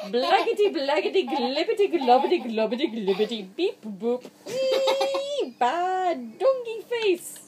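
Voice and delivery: frantic nonsense. Acted. Reader powering through nonsense for the child, frantic laughing gibberish. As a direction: rattle it off in giddy panic.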